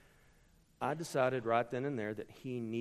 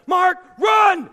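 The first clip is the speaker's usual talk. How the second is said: loud, high shout across a field; dad yelling; 19 dB louder than usual. Retold or imitated. Father shouting from the stands to his son, a loud yell. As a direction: yell at the boy to run.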